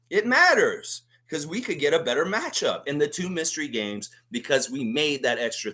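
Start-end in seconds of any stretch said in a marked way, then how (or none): 0.1-1.0 emphatic. Real, not acted. Ranting at a columnist.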